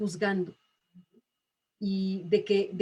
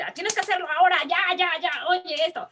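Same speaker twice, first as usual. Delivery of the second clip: higher and urgent, hurrying someone along; mock a teacher rushing the student; pushy, violent demand; 7 semitones higher, faster than usual. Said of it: "es que esta violencia de". (exigencia agresiva y apremiante, más aguda)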